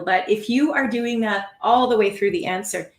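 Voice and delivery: usual speaking voice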